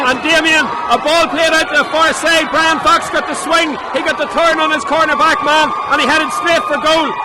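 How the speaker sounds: breathless replay; excited analysis. Real, not acted. Co-commentator replays the goal.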